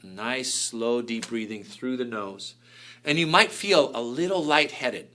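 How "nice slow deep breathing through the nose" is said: quiet, slow, soothing voice; soft guided breathing. Shown as a demonstration. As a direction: ease them into slow breaths. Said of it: "Now slow breathing".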